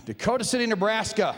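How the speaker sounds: ordinary talk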